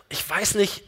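ordinary talk

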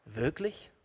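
very soft questioning rise; doubting. Acted. Devil planting doubt with one word, softly questioning. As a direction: let the word hang as a quiet doubt. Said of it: "aber dieses eine Wort".

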